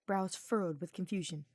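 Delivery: plain reading voice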